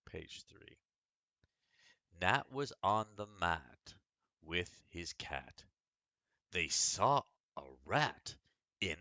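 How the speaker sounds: lively storybook reading; story reading. Acted. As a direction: read it like a picture book to children. Reads a picture book aloud.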